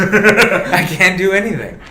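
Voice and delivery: high, loud, drawn-out mock wail; hammed-up helpless complaint; mock helplessness. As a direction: whine it slowly with a laugh. Mocks Jim's affable helplessness.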